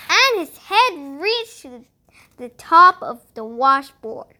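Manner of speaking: plain reading voice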